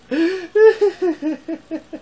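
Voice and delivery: big high cackle; loud high laugh. Real, not acted. Vlogger cackling at his own confusion, loud and high. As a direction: cackle loudly.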